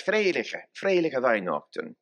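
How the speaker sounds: "Freiliche Weihnachten" in a homely German dialect, ei instead of ö. Shown as a demonstration. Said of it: "Or our dialect".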